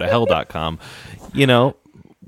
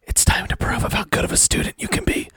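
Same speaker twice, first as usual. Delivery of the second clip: unvoiced, hurried stage whisper; 11 semitones higher, faster than usual. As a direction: whisper it quickly to a fellow player's character. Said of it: "I'm going to whisper to Nova".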